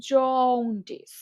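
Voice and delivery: জন্ডিস said slowly with lips rounded on the first vowel. Shown as a demonstration. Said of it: "ঠোঁটটা একটু গোল হয়ে আসবে".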